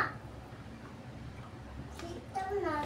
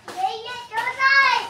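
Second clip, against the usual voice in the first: slow, drawn-out higher call; 4 semitones higher, 5 dB louder, slower than usual. Real, not acted. Child calling for Yaya, calling out slowly. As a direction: stretch the names out loudly.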